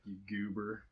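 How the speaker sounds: a lightly done American Southern accent mimicking Ben; Southern drawl impression. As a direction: say "You goober" in a Southern drawl, slow and easygoing. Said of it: "so he talks like this".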